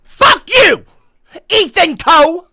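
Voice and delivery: high, very loud yelled insults; furious yelling. Real, not acted. Streamer insulting his chat, furious yelling. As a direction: spit each insult out at the top of your voice.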